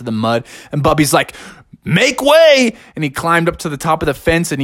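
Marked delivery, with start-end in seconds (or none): 1.8-2.7 excited call. Acted. Bear jumps into mud.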